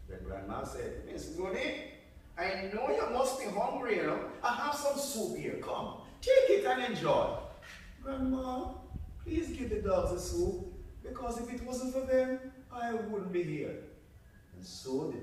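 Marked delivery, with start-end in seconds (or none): 1.0-7.4 a kindly grandma, rising higher and louder as she offers soup. Acted. 8.0-13.8 put-on higher, polite little girl asking for the dogs; good girl voice. Goody answers grandma.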